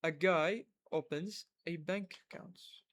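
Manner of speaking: very slow and clear; slow shadowing demo. Shown as a demonstration. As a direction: read it slowly for them to repeat. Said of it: "تسد المقطع وتحكي وراي". (قراءة بطيئة جدًا للترديد)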